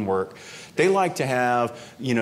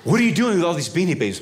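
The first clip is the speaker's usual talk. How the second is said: higher, louder and faster demand; sharper agent demand; 6 semitones higher, 4 dB louder, faster than usual. Retold or imitated. Customs agent presses the traveller, faster louder demand. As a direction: press him hard.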